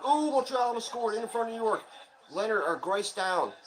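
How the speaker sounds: sudden high, loud burst of live commentary; excited play-by-play. Real, not acted. Fan reacting live to a near goal, excited play-by-play. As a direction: call the near-goal as it happens.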